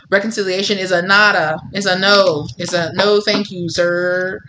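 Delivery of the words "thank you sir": drawn out, mocking politeness; slow dismissive. Real, not acted. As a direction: stretch it out, dismissive.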